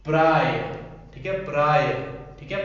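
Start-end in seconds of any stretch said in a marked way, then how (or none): 0.0-0.5 प्राय said loud and firm, falling; louder word demo. Shown as a demonstration. Teacher to students, loud demo of प्राय. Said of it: "प्राय पढ़ते हैं उसको". 1.4-1.9 प्राय said loud and firm, falling; louder word demo. Shown as a demonstration. Teacher to students, loud demo of प्राय. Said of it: "प्राय पढ़ते हैं उसको".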